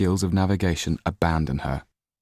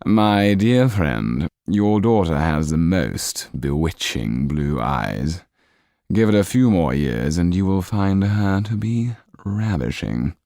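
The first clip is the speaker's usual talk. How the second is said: sly Count; 4 dB louder, slower than usual. Acted. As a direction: savour each word, amused. Count praises daughter.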